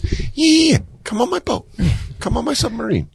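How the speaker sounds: very high mock billionaire voice; high-pitched, hammed-up eagerness; eager invitation. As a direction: coax a pal aboard in a silly high pitch. Acted eager billionaire inviting friend aboard.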